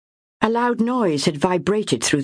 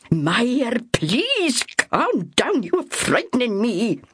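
slow, drawn-out please, trying to steady her; calming but uneasy; 6 semitones higher, slower than usual. Acted. Ethel trying to calm panicking Myra, slow uneasy plea. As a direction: soothe her while your own nerve slips.

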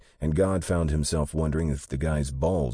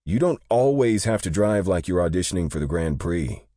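huffy complaint; 5 semitones higher, 5 dB louder than usual. A narrator's reading. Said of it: "Day huffed".

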